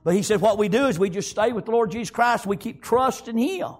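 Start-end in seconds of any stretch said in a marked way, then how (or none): none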